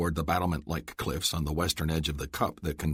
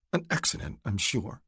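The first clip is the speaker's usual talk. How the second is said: higher, dry sarcastic An accident; sarcastic disbelief; 5 semitones higher than usual. A narrator's reading. Adele doubts her mother's death was an accident, sarcastic. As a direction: say it so no one believes it. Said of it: "Adele's tone made it clear she didn't believe".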